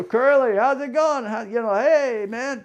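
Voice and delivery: high, loud, delighted hello; cheery greeting. Retold or imitated. Bar buddies happily greeting Curly, high and loud. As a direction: hail a drinking buddy across the yard.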